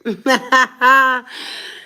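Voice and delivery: loud burst of laughter. Real, not acted. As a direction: laugh out loud at your own joke. Laughing at her own joke.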